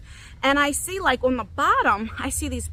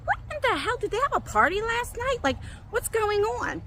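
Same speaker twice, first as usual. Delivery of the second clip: high-pitched, incredulous outburst; bewildered reaction, voice way up high; retold exasperated disbelief; 10 semitones higher than usual. Retold shock at mouse droppings.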